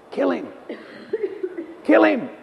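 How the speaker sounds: the flesh demanding blood; flesh crying out. Retold or imitated. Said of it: "what was that voice saying".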